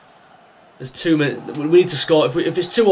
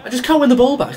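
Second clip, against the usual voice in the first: much higher, louder fed-up cry; exasperated complaint; 10 semitones higher, 4 dB louder than usual. Real, not acted. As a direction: vent the frustration out loud. Frustrated at losing the ball.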